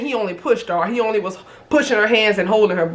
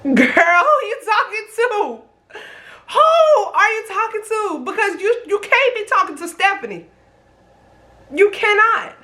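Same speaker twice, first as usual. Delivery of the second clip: incredulous anger; 9 semitones higher than usual. Retold or imitated. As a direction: demand who she's talking to. Retold outrage, reliving fight.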